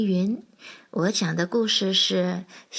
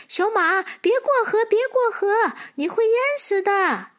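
high, urgent squirrel cry; squirrel's alarmed cry; 11 semitones higher, 4 dB louder than usual. Acted. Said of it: "大叫". (小松鼠尖声大叫着阻拦)

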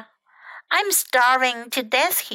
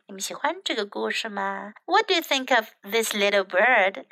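ordinary delivery

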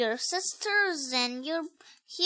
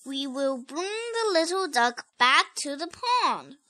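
plain reading voice

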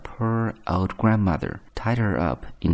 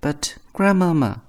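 put-on high girlish voice; little girl calling her grandmamma; 9 semitones higher, 4 dB louder than usual. A narrator's reading. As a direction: pitch it well up like a child. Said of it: "said the little girl".

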